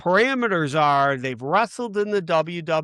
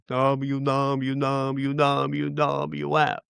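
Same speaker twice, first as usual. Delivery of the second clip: Andre the Giant impression; 5 dB quieter, much slower than usual. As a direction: drawl the letters out like a giant. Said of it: "as Andre the Giant says".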